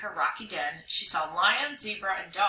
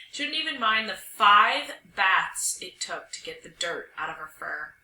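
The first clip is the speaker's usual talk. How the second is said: ordinary delivery, nothing marked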